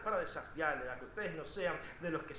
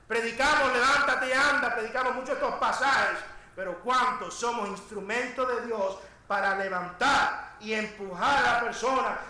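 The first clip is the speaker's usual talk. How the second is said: loud, raised exhorting voice; full-force preaching; 6 semitones higher, 11 dB louder than usual. Acted. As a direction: urge the congregation on at full volume. (exhortación de púlpito a plena voz)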